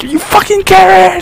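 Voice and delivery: very high, drawn-out outraged yell; mock outrage, stretched out; mock angry yell. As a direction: imitate someone hurling an insult, high and slow. Acted insult yelled at someone.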